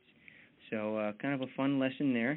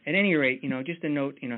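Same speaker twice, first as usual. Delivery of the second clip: ordinary talk, nothing marked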